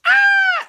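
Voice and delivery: very high, loud mock scream; imitated scream. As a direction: shriek like a terrified movie girl. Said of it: "And she screams".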